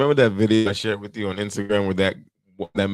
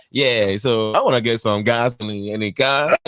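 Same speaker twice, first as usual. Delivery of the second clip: put-on slow, drawn-out Nigerian accent; lilting mock Nigerian accent; singsong Nigerian accent; 2 semitones higher than usual. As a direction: imitate a Nigerian customer, lifting the end. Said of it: "It's like they singing".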